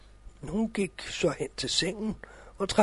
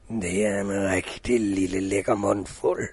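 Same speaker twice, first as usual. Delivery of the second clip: wolf voice; 9 semitones lower than usual. Acted. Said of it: "tænkte ulven ved sig selv".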